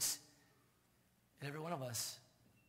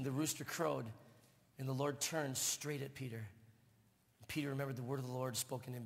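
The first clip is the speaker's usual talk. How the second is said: ordinary talk, nothing marked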